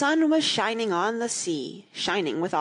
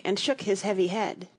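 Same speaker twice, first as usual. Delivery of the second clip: ordinary delivery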